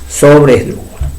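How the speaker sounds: ordinary talk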